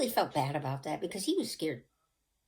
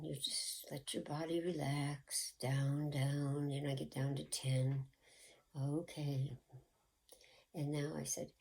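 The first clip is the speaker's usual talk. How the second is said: soothing hypnotist; 7 dB quieter than usual. Retold or imitated. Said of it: "I must have had a soothing voice".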